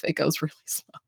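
whispered aside, laughing through it; mock-confidential whisper. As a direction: drop to a giggly whisper on the punchline. Whispered joke about boring coronation.

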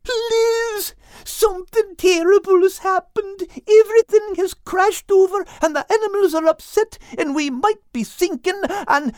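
high, fast, frantic worry; panicked Albert. Acted. Panicked Al voice in story.

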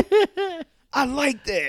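high, excited wrestler imitation; delighted exclamation. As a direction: gush over a rival's accent. Acts wrestler's delighted reply to rival.

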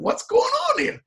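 high, startled retold question; a hammed-up burst of alarm; mock scared cry. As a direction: squeak it out like a frightened man. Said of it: "I was scared".

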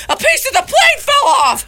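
high, loud panicked scream. Retold or imitated. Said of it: "the guy's screaming".

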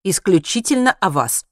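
loud, insistent annoyance; irritated. A narrator's reading. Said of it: "раздражённо сказал Хайман". (раздражённо, с нажимом)

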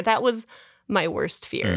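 ordinary talk, nothing marked

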